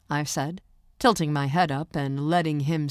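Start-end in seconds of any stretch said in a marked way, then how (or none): none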